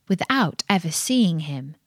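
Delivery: plain reading voice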